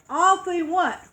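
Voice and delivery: ordinary delivery, nothing marked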